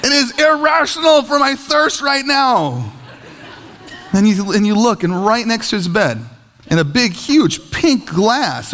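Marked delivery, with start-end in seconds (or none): 0.0-2.9 high, slow whiny wail; hammed-up child's complaint; wailing kid. Acts the wailing thirsty kid.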